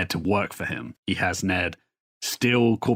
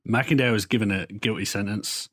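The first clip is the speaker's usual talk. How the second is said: normal talking voice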